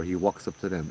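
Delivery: ordinary delivery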